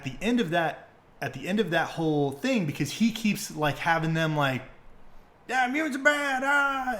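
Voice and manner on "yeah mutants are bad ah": high, strained caricature of a hater; mock bigot rant. Host mimicking the anti-mutant group's followers, mocking rant. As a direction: put on a silly high-pitched hateful cry.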